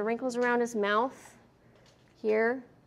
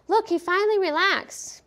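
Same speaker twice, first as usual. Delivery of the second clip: mock relieved owner; 9 semitones higher, 3 dB louder than usual. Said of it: "dogs that'll come in".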